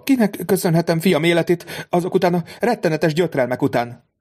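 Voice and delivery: a grateful, trembling mother; tearful mother. A narrator's reading. Mother asks the count whom she must thank, tearfully.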